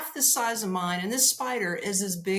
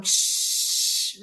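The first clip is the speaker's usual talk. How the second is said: spray hiss; 4 dB louder than usual. Retold or imitated. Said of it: "I'm like".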